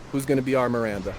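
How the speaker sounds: ordinary talk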